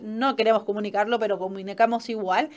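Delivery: usual speaking voice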